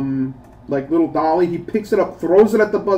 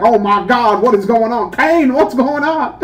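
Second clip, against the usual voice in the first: mock Vince McMahon; 6 semitones higher, 4 dB louder than usual. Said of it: "Vince McMahon was gonna come out and be like".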